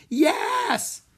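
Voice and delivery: very high, loud scream. A narrator's reading. Audience answering Harry a second time, screaming. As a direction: shriek it like a thrilled crowd. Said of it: "the audience screamed".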